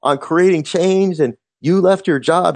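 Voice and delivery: high and slow, eager recognition; excited fan. Retold or imitated. Fan listing the host's life story, high eager gush. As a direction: rattle off facts like a devoted fan. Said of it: "he goes".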